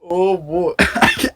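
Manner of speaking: normal talking voice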